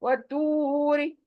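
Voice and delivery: slow वतूरी, no stop on री; stretched vowel. Shown as a demonstration. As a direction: stretch वतूरी slowly, let री flow. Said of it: "री को stop".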